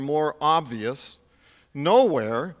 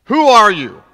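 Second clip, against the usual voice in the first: loud angry demand, higher and louder; upset emperor; 5 semitones higher, 12 dB louder than usual. Retold or imitated. Emperor shouting at the gladiator who turned his back. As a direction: bark it like an outraged emperor. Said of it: "Commodus gets very upset and says".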